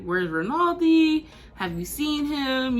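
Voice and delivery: a hint of a fretful sister asking around; worried sister. Sister texting the narrator about her missing brother, lightly voiced worry.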